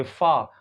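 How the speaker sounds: फा with a drawn-out "aa"; long vowel. Shown as a demonstration. Teacher showing the vowel in father, held long. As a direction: hold the "aa" of फा as in father. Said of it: "थोड़ा longer पढ़ते हैं".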